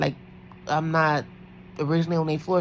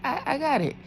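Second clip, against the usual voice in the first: much higher, sing-song polite voice; high polite agreeing. Retold or imitated. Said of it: "So I was like".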